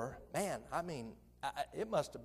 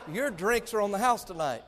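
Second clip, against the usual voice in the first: louder, higher retelling of the good news; preacher repeating the barista's surprise, louder; emphatic repeat; 6 semitones higher, 10 dB louder than usual. Retold or imitated. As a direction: repeat it big for the crowd. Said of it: "Say what?".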